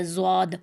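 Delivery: زواد with lips rounded into a w; wrong rounded zwaad. Shown as a demonstration. Teacher demonstrates the faulty letter, lip-rounded زواد. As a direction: say زواد as "zwaad", gliding through w. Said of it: "واو کی آواز بالکل غلط ہے".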